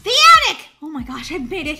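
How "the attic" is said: high, loud cry of discovery; excited shout. Real, not acted. Player reaching the attic, excited shout. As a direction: yell it out in triumph.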